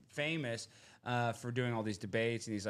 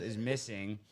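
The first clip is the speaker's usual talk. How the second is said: normal talking voice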